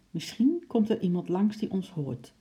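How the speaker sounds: ordinary delivery, nothing marked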